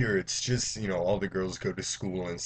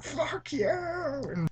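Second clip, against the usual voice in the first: mock screaming; much slower than usual. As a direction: squeal it like a horror victim. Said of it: "So just screaming".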